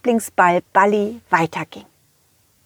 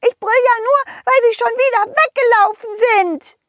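high huffy fairy voice; 13 semitones higher than usual. Acted. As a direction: defend yourself as a cross fairy. Fee rechtfertigt sich. (trotzige Feenstimme)

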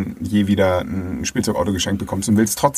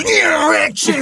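high, loud excited shout; excited dad; 5 dB louder, much slower than usual. Retold or imitated. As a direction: cheer it out like a thrilled father. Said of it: "und er so". (begeisterter Vater)

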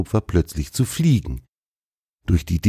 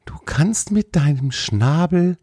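high, wondering animal-character voice; impressed deer; 12 semitones higher than usual. A narrator's reading. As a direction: ask in high-pitched amazement. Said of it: "fragte er beeindruckt".

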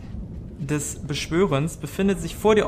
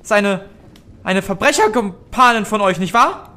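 frightened innkeeper; faster than usual. Acted.

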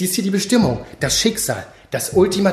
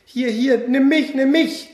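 high, eager cries of nimm mich; excited shouting; 7 semitones higher than usual. Acted. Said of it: "schrie ein Love-Teddy von ganz oben".

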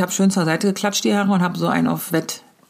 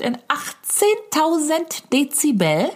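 put-on child voice; 8 semitones higher, much slower than usual. Child repeating the question at the comedian, high and slow. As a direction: stretch it out like a kid. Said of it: "sagt das Kind".

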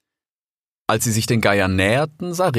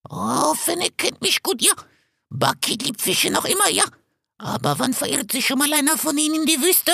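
much higher, rasping vulture voice; hoarse vulture. A narrator's reading. Vulture Bakit questioning the fox, hoarse. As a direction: croak it out like an old vulture.

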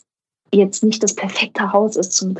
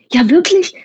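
hopeful surprise; 9 semitones higher, 4 dB louder than usual. Retold or imitated. Crew hopeful about staying.

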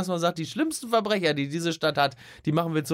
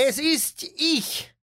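hammed-up announcement; 9 semitones higher, much slower than usual. Hammy self-introduction.

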